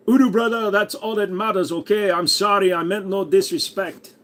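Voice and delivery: a light hammed-up Jamaican accent, high; playful bad Jamaican accent; mock Jamaican accent. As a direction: greet him in a put-on Jamaican accent. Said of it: "made you half Jamaican".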